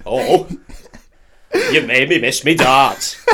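booming mock-Yorkshire soap character; Fred Elliott. Soap character complaining about his darts, loud hammed-up Yorkshire. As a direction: ham up a loud, stuttering Yorkshire blusterer. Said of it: "Fred Elliott from Yorkshire".

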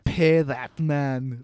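put-on very slow, overdone Russian accent. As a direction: ham up a bad Russian accent, trying way too hard. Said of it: "Rounders with the Russian accent".

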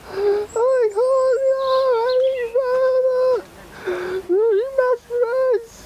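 shrill high weary voice, drawn out; very high exhausted whine. Acted. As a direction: wail that you can't go on. Exhausted figure begs to rest.

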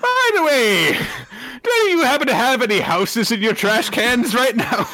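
high, loud laughing question. Acted. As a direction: laugh through the line.

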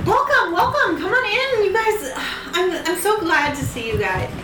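high, sweet, overly welcoming host; too-friendly hostess with something off; calm psychopath. Acted. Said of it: "She's talking like a serial killer".

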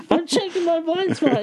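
squeaky high mock defence of herself; flustered student self. Student answering instructor, squeaky high flustered mock voice. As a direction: protest innocently in a tiny high voice. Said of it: "I'm like".

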